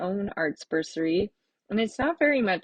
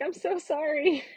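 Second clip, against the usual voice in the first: apologetic self; 9 semitones higher than usual. Retold or imitated. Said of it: "I'm like".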